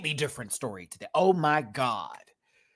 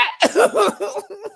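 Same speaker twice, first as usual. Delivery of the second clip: a quick, very high laugh; high squeal of laughter; 5 dB louder than usual. Real, not acted. Laughing at the comment.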